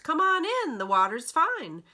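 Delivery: high, loud call from the water; shouted invitation. Acted. Brothers call the scared duck into the pond, loud and high. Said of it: "My brothers swimming in a line shout".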